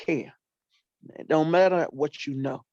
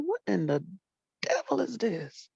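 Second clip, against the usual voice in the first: surprised whisper; 4 semitones higher, 5 dB quieter than usual. Retold or imitated. Hearing sniffling beside her.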